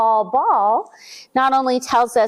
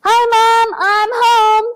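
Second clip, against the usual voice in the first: higher, louder call home; Bobby shouting; 9 semitones higher, 5 dB louder, slower than usual. A narrator's reading. Boy calling to his mom as he gets home, loud and high. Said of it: "Bobby shouts".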